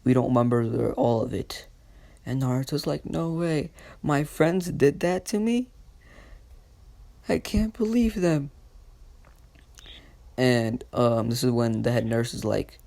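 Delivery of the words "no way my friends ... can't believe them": high-pitched acted dismay; hurt disbelief. Boy learns his friends hurt him, dismayed. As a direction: climb higher in pitch, unable to believe it.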